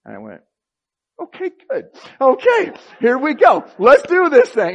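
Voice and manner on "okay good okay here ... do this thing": much higher and louder, bursting with eagerness; fired up. Retold or imitated. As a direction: jump in like you can't wait to start. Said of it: "And I just got fired up".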